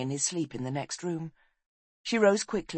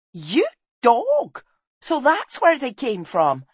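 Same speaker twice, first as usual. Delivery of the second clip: high, loud accusing cry; indignant outburst; 8 semitones higher, 7 dB louder, slower than usual. Acted. Wife catches husband out over the ticks, indignant. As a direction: round on him, pitched up and loud.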